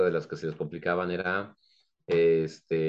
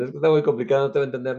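high, louder complaining voice; mock protest; 7 semitones higher, 4 dB louder, much faster than usual. Family complaining the words are too complicated, in a high whiny protest. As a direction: whine that it's too hard to follow. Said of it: "Que decían".

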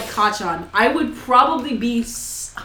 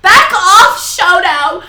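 loud, slow, high possessive fan shout; mocking angry Wattpad commenters with a loud yell; 7 semitones higher, 8 dB louder, much slower than usual. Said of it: "the Wattpad comments were like".

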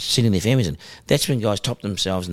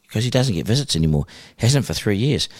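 ordinary talk, nothing marked

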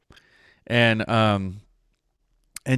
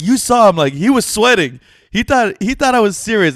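loud, high mock crowing; retold gloating; 10 semitones higher, 6 dB louder than usual. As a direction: imitate a prankster gleefully rubbing it in.

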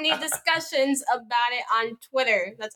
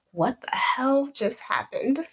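stunned disbelief; 4 semitones higher, slower than usual. Retold or imitated. Stunned by his order.

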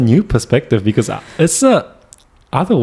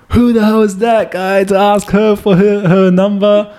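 jealous outburst; 9 semitones higher than usual. Retold or imitated. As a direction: vent like a jealous boyfriend. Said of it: "I was jealous".

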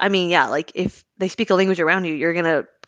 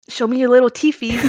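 put-on baby voice; 5 semitones higher than usual. Shown as a demonstration. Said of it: "That's literally just baby voice".

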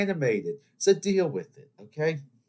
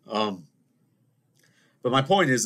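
usual speaking voice

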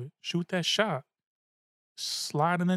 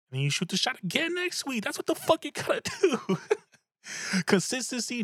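excited; 11 semitones higher than usual. Real, not acted.

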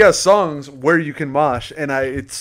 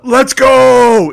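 hyped bro cheer; 10 semitones higher, 9 dB louder than usual. Shown as a demonstration. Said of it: "for straight guys, the".

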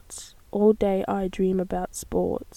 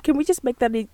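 usual speaking voice

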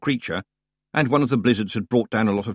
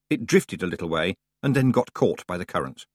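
normal talking voice

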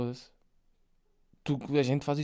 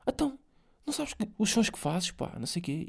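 manager voice; 7 semitones higher than usual. Retold or imitated. Manager answering the artist.

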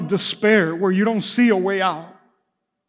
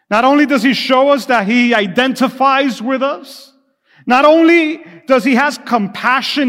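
higher, louder rising preacher cadence; preacher building to a climax for the congregation; building sermon; 4 semitones higher, 3 dB louder than usual. Acted. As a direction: stack each not only higher and louder.

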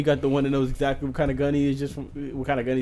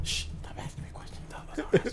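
whispered, higher, slow shushing; mock hush; 10 semitones higher, 7 dB quieter, slower than usual. Gun seller hushing a legality question, in a slow whisper. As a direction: hush the customer like a shady dealer.

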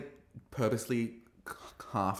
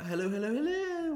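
high sing-out hammy hello, climbing higher; 3 dB louder than usual. Retold or imitated. Host greeting the queens, high imitated sing-out. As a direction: trill the greeting like a showy host. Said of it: "and then [name]'s like".

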